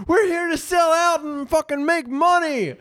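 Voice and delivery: very high, hammed-up yell; mock shout. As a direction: crow it as a shameless sellout. Mock shout exaggerating co-host's praise.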